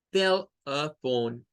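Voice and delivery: "Telephone" slowly in three syllables, tel stressed; slow teaching demo of "Telephone". Shown as a demonstration. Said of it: "Son tres sílabas".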